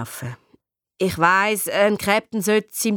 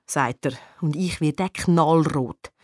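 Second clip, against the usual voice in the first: ordinary delivery, nothing marked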